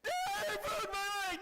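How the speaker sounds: put-on high, slow pleading boy; hurt boy begging his father; desperate kid. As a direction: plead with dad, scared. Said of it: "I'm like".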